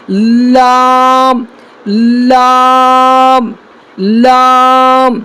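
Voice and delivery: لام held very long, tongue at the upper teeth; slow model of لام; drawn-out letter demo. Shown as a demonstration. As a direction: stretch each لام slowly. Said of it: "جیسے دیکھیں، لام".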